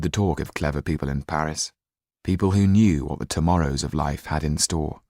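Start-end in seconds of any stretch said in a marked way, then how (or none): none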